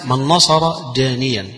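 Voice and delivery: ordinary talk, nothing marked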